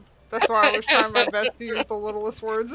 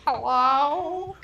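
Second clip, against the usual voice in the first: imitating grandma; 4 semitones higher than usual. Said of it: "Like Nani will go".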